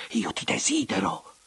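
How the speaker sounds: high, breathy burst of desire; impassioned declaration. A narrator's reading. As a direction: let the longing break out, pitched high.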